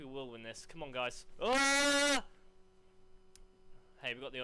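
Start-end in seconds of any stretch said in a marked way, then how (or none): 1.4-2.2 loud, high sustained scream; startled scream. Real, not acted. Gamer caught off guard mid-match, screaming. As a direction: let out a long high yell. Startled cry during play.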